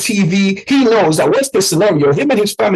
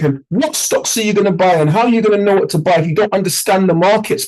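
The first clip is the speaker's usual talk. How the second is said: ordinary talk, nothing marked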